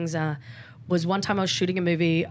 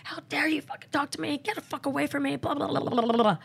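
high, reined-in mock tirade; high-pitched indignant rant, not shouted; retold losing it; 7 semitones higher, 3 dB quieter than usual. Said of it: "I lost it, like, lost it big time".

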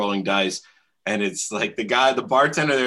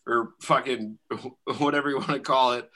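ordinary talk

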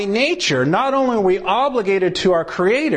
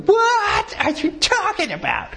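confused protest; 12 semitones higher than usual. Retold or imitated. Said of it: "This is the one that's going".

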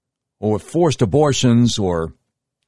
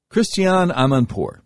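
usual speaking voice

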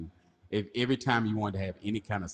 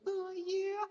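tiny high falsetto; mock pleasure moan; 6 dB quieter than usual. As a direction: squeal it softly in pretend pleasure. Acting out a sexual moan.

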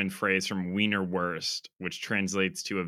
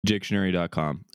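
usual speaking voice

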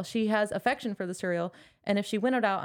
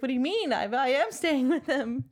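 a touch of panic, voice pitched up; panicked; 6 semitones higher than usual. Retold or imitated. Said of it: "That would have scared the shit out of me".

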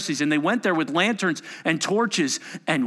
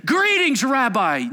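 much higher and louder, a gushing fake-friendly hello; mock excited greeting; 9 semitones higher, 5 dB louder, slower than usual. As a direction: greet him like an overeager friend hiding a betrayal. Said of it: "call him rabbi all excited".

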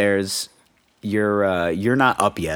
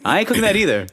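teasing dad; 10 semitones higher, faster than usual. Retold or imitated.

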